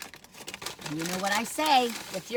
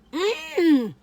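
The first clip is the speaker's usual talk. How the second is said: high pleased mm; 10 semitones higher, 7 dB louder than usual. Real, not acted. Savoring bite.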